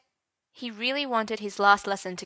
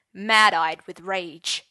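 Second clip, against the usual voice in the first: plain reading voice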